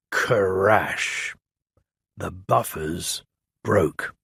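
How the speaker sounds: plain reading voice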